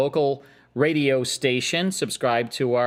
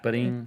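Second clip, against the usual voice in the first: vocal rim shot; 4 dB quieter than usual. Pretend. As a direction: mouth drum rim shot Ba-ding. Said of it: "I need a rim shot".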